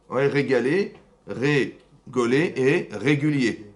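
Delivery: « rigoler et régulier » détachés lentement, chaque mot posé; slow wordplay. Shown as a demonstration. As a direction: savoure le jeu de mots en ralentissant. (jeu de mots énoncé lentement)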